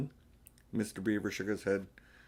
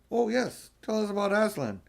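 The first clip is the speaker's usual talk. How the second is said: high, excited chorus; can't wait to hear; eager children; 11 semitones higher, 4 dB louder than usual. Acted.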